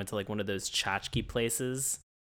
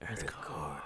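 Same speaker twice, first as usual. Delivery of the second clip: softer, lower breathy whisper; creepy whisper; 8 semitones lower, 10 dB quieter than usual. Pretend. Whispering Earth Core before walking away. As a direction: hiss it out like a secret. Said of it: "And just whisper".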